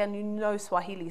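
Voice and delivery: ordinary talk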